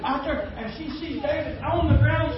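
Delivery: usual speaking voice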